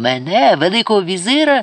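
outraged, rising challenge; indignant outrage. A narrator's reading. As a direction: protest as an insulted grandee. Enraged vizier refuses arrest.

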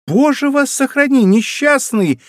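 high, loud outcry of warning; alarmed cry. A narrator's reading. Montalais begs Malicorne not to warn Raoul, alarmed cry. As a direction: cry out sharply to stop him. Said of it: "вскричала Монтале".

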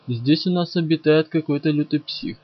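plain reading voice

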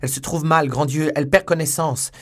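loud, high alarm; panicked cry. A narrator's reading. Young man watching his beloved faint, panicked shout. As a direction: shout it out in distress.

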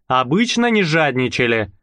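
ordinary delivery

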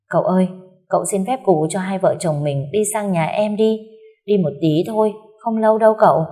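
ordinary delivery, nothing marked

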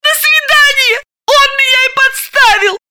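high, loud, tearful outburst; shrieking angry woman; furious shout. A narrator's reading. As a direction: yell the betrayal at her friend. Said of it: "яростно сообщила Галина".

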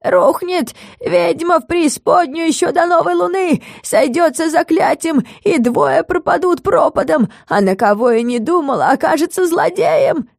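squeaky prophecy. A narrator's reading. Long-necked oracle foretelling the witch's fall, squeaking. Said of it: "и пропищал".

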